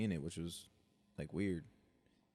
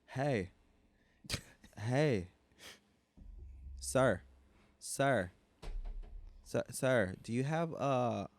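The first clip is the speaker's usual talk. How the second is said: put-on higher, louder drunk calling out; drunk woman voice; 4 semitones higher, 4 dB louder, much slower than usual. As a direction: call "sir" over and over, tipsy. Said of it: "She's like".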